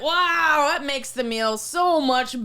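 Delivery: loud, gushing mock delight; over-the-top approval. Customer to server as candle arrives, over-the-top approval. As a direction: imitate a customer hugely overreacting, drawn out.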